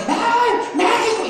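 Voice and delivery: high, slow hammed-up cry of a soccer fan; mock fan shout. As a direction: ham it up like a fan celebrating at the Ángel. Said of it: "va a ir al Ángel a gritar como el otro". (grito burlón de aficionado, alto y lento)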